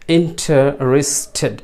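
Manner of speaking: ইন্টারেস্টেড broken slowly into syllables; slow model pronunciation. Shown as a demonstration. Teacher modelling the English word, ইন্টারেস্টেড slowly syllabified. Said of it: "ইংরেজি বলবেন ইন্টারেস্টেড".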